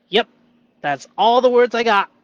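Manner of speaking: loud, high, hammed-up agreement; playful exaggerated agreement. Agreeing with his shadow voice, hammed-up.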